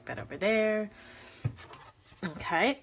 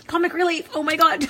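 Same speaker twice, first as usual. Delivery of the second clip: high, loud laughter through words; laughing; 9 semitones higher, 6 dB louder than usual. Real, not acted. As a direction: laugh it off after the pain. Laughing after stubbing her toe.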